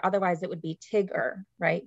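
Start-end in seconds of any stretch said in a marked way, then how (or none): 0.9-1.5 "tigger" with a short i, rhyming with bigger; short-i mispronunciation. Shown as a demonstration. Teacher showing the wrong split, short-i "tigger" for tiger. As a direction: say "tigger" with the first syllable closed by the g. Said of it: "I would split it after the G".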